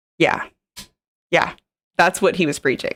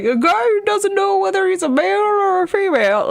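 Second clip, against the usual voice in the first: mocking imitation. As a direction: mimic him in a silly, much higher voice. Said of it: "this guy's like".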